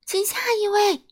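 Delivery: high, hollered call to the queue; thunderous call. A narrator's reading. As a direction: yell for the next customer. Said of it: "声音就像打雷一样". (像打雷一样喊下一位)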